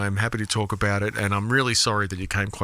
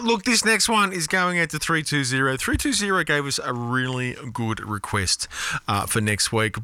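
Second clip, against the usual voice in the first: ordinary talk, nothing marked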